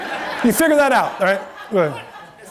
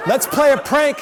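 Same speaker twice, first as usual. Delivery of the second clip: excited prank idea; 6 semitones higher, 3 dB louder than usual. Retold or imitated. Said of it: "And I go".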